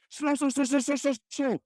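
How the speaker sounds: put-on mother panicking at a toddler; panicked screaming. Sister-in-law screaming at her daughter, panicked high shouts.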